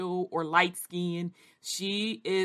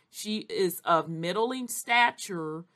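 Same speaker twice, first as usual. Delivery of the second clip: usual speaking voice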